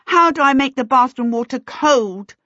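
loud, high urgent question; shouting. A narrator's reading. Charmaine asks her great-uncle about the hot water, shouting. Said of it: "she shouted".

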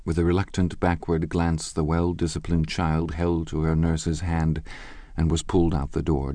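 plain reading voice

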